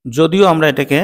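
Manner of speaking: usual speaking voice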